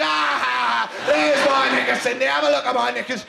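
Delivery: put-on loud, shameless old cockney; mad cockney granny. Granny greeting grandchild's girlfriend Cindy, mad cockney crowing. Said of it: "some mad old cockney granny cackling away".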